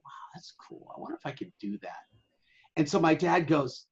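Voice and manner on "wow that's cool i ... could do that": whispered, much softer; hushed wonder. Retold or imitated. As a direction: breathe it like parents dreaming on the beach. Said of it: "they go".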